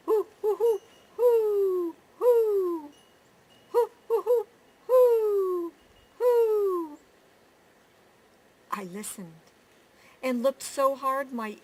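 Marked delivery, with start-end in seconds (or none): 0.1-2.9 high, loud hooting like a great horned owl; owl hoots. Acted. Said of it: "And then Pa called". 3.7-7.0 high, loud hooting like a great horned owl; owl hoots. Acted. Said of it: "And then Pa called".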